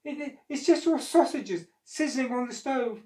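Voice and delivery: high, stammering excuse; enormous lady lying to the giant; nervous excuse. Acted. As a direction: stammer a quick cover story. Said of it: "said the enormous lady".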